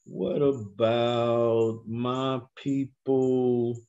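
"What" drawn out, very slow; stress on what. Shown as a demonstration. Preacher teasing the congregation, very slow question. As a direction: stretch "What about my people" out slowly. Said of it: "preacher swapping in other nations".

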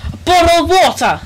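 high, loud mock British accent, bottle with dropped t; over-the-top British accent, "mate" falling; hammed-up British accent. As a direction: play a cheery British bloke offering a drink. Mock British offering tea, "mate.

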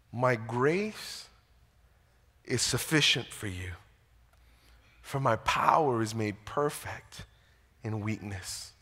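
low, hushed quoted words of God; soft and measured, pausing between phrases; solemn reassurance. Retold or imitated. God answers Paul's plea about the thorn, solemn reassurance.

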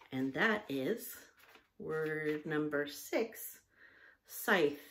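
usual speaking voice

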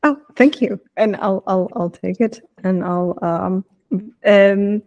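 normal talking voice

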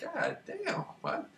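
stunned. Retold or imitated. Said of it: "I'm like".

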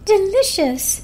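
high, loud exclaim of delight; delighted declaration. Acted. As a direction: savour it out loud with joy. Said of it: "Marjorie declared".

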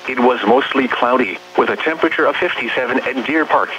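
ordinary delivery

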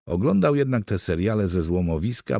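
ordinary delivery

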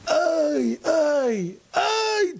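high, drawn "ay" cries; imitated yelps. Father reminding his son of the icy dip, mock yelping. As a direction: mimic the cries, climbing in pitch. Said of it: "צעקת, נכון?".

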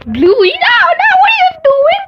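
high, loud upset outburst; shocked shout. Real, not acted. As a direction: protest the pick loudly. Shocked at the result.

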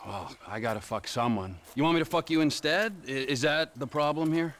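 ordinary delivery

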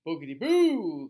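loud ghostly boogity boo; spooky skeleton shout. Acted. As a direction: boom it out to scare. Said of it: "clacked the skeleton".

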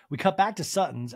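ordinary delivery

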